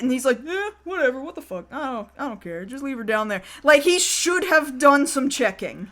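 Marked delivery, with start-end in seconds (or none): 0.5-3.4 soft, trailing-off dismissive mumble; mock indifference. Boss waving away his henchman's report, dismissive. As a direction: shrug it off as a careless boss.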